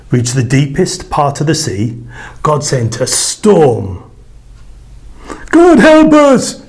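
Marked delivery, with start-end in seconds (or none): 5.5-6.6 high, loud, slow panicked cry; terrified cry. A narrator's reading. Sailor in the storm crying out in terror. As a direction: cry out in terror as the boat sinks. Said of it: "cried a sailor".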